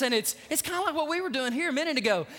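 usual speaking voice

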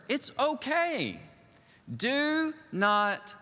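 slow, gentle mock-angel comfort; reassuring angel. Angel calming the startled Mary, slow and reassuring. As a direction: soothe a frightened girl slowly. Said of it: "the angel had to say these words".

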